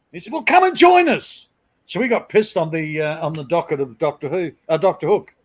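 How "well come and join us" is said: very high, light imitation of a friendly rocker; Ray's invitation. Ray inviting the group over, lightly voiced easygoing invite. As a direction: offer it breezily.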